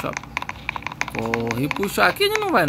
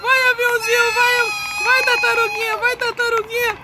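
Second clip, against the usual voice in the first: frantic high cheering; 14 semitones higher, slower than usual. Pretend. Urging the plane to escape.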